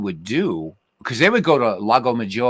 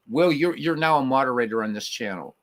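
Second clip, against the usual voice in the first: normal talking voice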